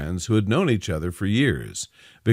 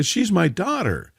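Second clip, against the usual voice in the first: outraged yell; 10 semitones higher than usual. A narrator's reading. Mother confronting her daughter's fiancé, indignant yell. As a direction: pitch it up in protective outrage. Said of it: "yelled Victoria".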